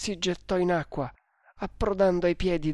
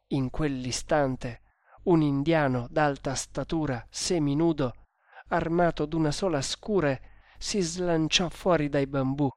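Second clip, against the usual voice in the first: usual speaking voice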